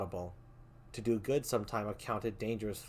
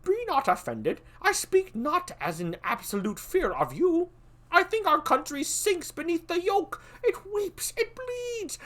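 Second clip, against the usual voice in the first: put-on much higher, cautious young prince; young Malcolm; 7 dB louder than usual. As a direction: weigh each word warily, pitched high.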